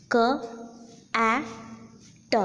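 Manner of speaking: "K, a, t" as three isolated sounds, long pauses between; separate sounds. Shown as a demonstration. Lecturer showing three distinct sounds in the word, "K, a, t" sounded out separately. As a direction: sound out "K, a, t" one by one. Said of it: "three distinct sounds in this word".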